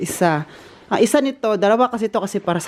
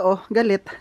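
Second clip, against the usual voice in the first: normal talking voice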